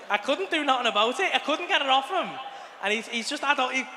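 normal talking voice

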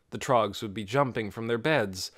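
plain reading voice

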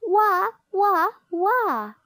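high, loud storybook "wah" crying; mock baby wail. A narrator's reading. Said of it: "and still she cried".

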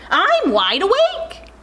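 high-pitched little bird voice; baby bird. A narrator's reading. Baby bird answers giraffe, high chick voice. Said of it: "said the baby, baby bird".

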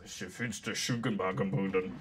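lightly voiced villain with mock-German gibberish; low movie-villain imitation; a hint of a German-sounding villain, kept low; Alan Rickman. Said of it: "Alan Rickman goes".

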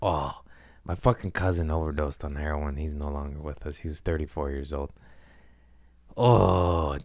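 quiet, holding back tears; fighting tears, low and soft; putting on a brave face. Real, not acted. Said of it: "I'm trying not to cry".

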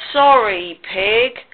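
mildly contrite, drawn-out sorry; lightly voiced contrite cow; contrite apology. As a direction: apologise with a touch of regret. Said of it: "said Cow contritely".

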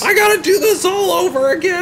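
very high-pitched retold complaint; exasperated wail. Gamer retelling lost progress in the game, exasperated wail. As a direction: whine it way up high in disbelief.

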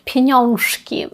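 піньонжки said very slowly, mock sweet; diminutive Polish slang. Shown as a demonstration. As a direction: stretch out піньонжки slowly and cutely. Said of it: "а тут".

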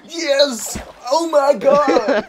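overhyped, high triumphant shout; loud, stretched-out mock celebration; Dream impression. Player teasing friend for overhyping a win, Dream impression. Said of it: "You sound just like Dream two after he does something".

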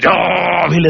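രാവിലെ with its first vowel stretched out; drawn-out first syllable of രാവിലെ; pressed-hard word. Retold or imitated. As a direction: lean hard and long on the start of രാവിലെ. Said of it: "അമർത്തി എഴുതി".

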